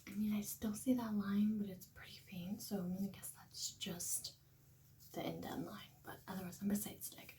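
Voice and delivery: soft early-morning whisper. Real, not acted. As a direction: whisper so you don't wake anyone. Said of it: "I have to be a little quiet".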